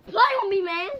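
high loud wail; distressed shout. Real, not acted. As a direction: cry out in protest. Upset after spitting.

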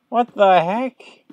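slow, high, drawn-out what the heck; baffled. Real, not acted. Gamer baffled by a missing window, slow and high. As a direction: stretch it out in disbelief.

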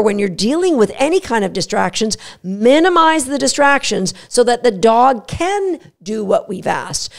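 normal talking voice

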